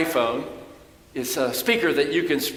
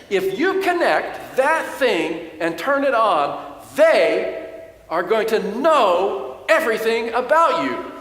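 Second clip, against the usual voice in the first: higher, louder, slow warning; retold agitated yell; 6 semitones higher, 4 dB louder, slower than usual. Preacher imitating his son's agitated warning about Amazon, loud mock alarm. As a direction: warn him gravely and loudly like a conspiracy buff. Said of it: "But he continued to yell".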